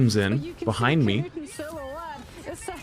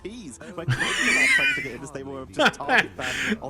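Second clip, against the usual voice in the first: burst of laughter; 3 dB quieter than usual. Real, not acted. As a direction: crack up laughing. Laughing at the absurd story.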